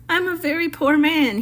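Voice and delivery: high, tearful muskrat; a lightly sobbed plea, voice up high; sobbing. Acted. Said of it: "he sobbed".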